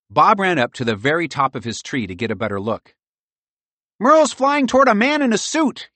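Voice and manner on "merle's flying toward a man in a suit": high-pitched urgent shout; high squirrel voice, raised; calling down a report. A narrator's reading. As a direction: call the news down from a treetop. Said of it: "he reported down to Pearl".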